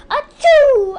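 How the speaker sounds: big loud, high sneeze. Acted. Girl walking to school, loud sudden sneeze. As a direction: sneeze it out hard. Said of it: "I sneeze".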